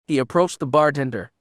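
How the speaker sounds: usual speaking voice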